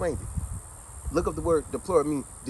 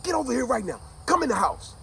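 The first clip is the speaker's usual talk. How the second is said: stern order; 7 semitones higher than usual. Retold or imitated. Father ordering his son inside.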